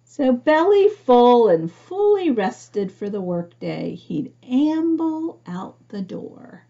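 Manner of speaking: put-on gentle read-aloud narration for children; storybook reading. A narrator's reading. Reading picture book aloud.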